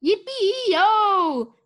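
high, loud holler; long, ringing cowboy cry; cowboy whoop. A narrator's reading. Cowboy's shout heard from outside.

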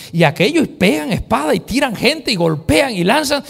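loud, high storytelling sermon; fervent preaching. Acted. Preacher tells the congregation the raid, fervent. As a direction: tell the raid with fire.

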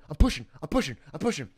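ordinary talk

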